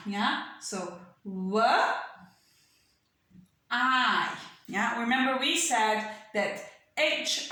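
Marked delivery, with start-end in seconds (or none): 1.3-4.4 "W, I" as wuh, long pause, eye; sounded out. Shown as a demonstration. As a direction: split why into two separate sounds. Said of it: "the last word I have here is Y".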